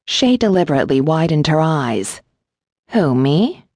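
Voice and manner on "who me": mock-innocent question swooping up at the end; feigned innocence. A narrator's reading. Shay feigning innocence to the gargoyle, mock-innocent. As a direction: play the wide-eyed innocent. Plays innocent with widened eyes.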